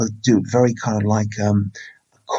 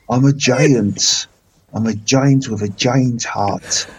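slow giant with an Irish lilt; slow, Irish-tinged giant voice; Irish-sounding Cornish; 2 semitones higher, slower than usual. Acted. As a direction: try Cornish, drift into Irish. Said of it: "That's more Irish".

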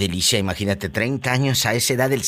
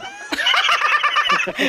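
high cackle. Acted. Host cackling at the husband's slip, high character laugh.

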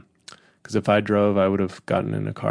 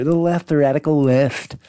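Don Junior; 4 semitones higher, 3 dB louder than usual. Retold or imitated. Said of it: "I sound like Don Junior".

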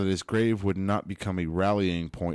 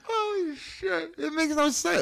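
slow high stunned exclaim; shocked squeal; slower than usual. Real, not acted. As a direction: squeal out in disbelief.

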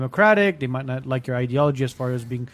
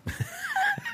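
high laugh; 8 dB quieter than usual. Real, not acted. Laughter at the cages joke.

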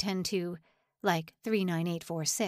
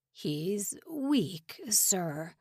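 whisper; much slower than usual. A narrator's reading. Old prisoner pleads to the officer.

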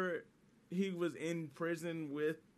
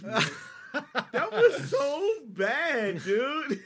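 high, loud, drawn-out laughing tease; teasing laughter; 11 semitones higher, 9 dB louder, much slower than usual. Real, not acted. Friend mocking a missed shot on camera, loudly laughing. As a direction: crack up at a friend's miss, very slow and loud.

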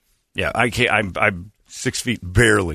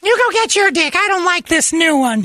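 high loud bossy imitation; shrill woman voice; 4 dB louder than usual. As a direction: squeal it like a demanding wife. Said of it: "screaming to get my".

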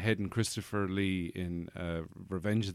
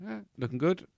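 breezy doctor; 9 semitones higher than usual. Retold or imitated. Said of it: "he'd go".

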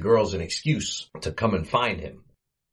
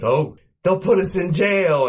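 higher panicked Larry wail; mock panic; 9 semitones higher, 4 dB louder than usual. Larry reading the tax letter, higher panicked wail. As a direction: squeal it, about to faint.

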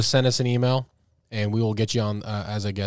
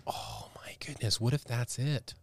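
awed whisper; 13 dB quieter, slower than usual. Real, not acted. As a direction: breathe it out in shock. Gasping realization.

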